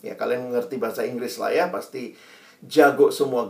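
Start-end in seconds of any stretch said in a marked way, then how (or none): none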